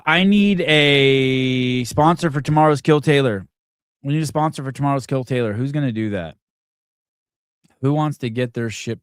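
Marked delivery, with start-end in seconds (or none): 0.1-3.5 booming, drawn-out call to listeners; slow loud announcement. Real, not acted. Host calling out to listeners for a sponsor, slow and loud. 4.0-5.5 rattled off fast, at normal volume; quick repeat. Real, not acted. Host repeating the sponsor request to listeners, fast and offhand.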